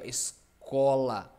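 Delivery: put-on "Escola" slow, as if it had an accent; student mocks how "Escola" would sound accented; mock stressed. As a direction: drag out "Escola" like a strange written accent. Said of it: "Aí você vai falar".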